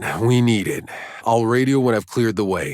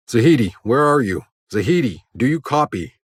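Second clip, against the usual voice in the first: urgent call; 5 semitones higher than usual. Acted. Explorer calling a missing Zahidi on the radio, urgent.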